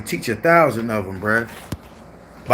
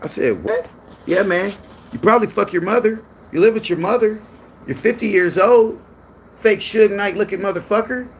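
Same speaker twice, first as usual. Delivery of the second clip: higher mocking Scar voice, no letup; imitating Scar; 7 semitones higher than usual. Retelling Scar's insults in a high mocking voice. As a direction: ham up the rapid-fire insults. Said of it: "He said".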